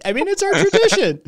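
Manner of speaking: very high, squeaky mock protest; hammed-up high-pitched protest; mock indignant. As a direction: squeak it out as fake outrage. Mock Canadian defending tradition.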